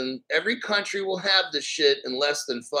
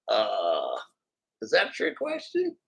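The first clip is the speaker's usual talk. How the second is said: mock puzzlement; 7 semitones lower, 5 dB quieter than usual. Answering imagined fans asking how he knew, mock puzzled. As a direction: drop low, then squeak up into the question. Acted reply to imagined amazed questioners.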